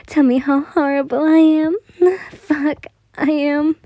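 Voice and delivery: high, laughing like a menace; shouting and laughing. A narrator's reading. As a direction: laugh through it like a maniac. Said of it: "he shouted, laughing like a menace".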